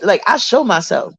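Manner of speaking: ordinary delivery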